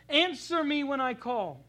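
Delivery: slow, loud, pressing demand aimed at God; demanding plea. Shown as a demonstration. Preacher showing David speaking at God, slow and demanding. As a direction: lean on it, demand an answer. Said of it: "he's speaking at God".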